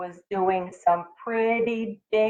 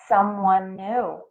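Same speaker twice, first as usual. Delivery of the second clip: ordinary delivery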